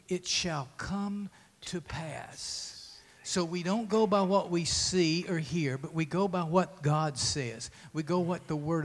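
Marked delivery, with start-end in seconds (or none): 0.1-2.7 slow whispered promise. Shown as a demonstration. A preacher whispering God's promise to the church. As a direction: breathe it out slowly, no voice. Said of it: "So what has he spoken to you?".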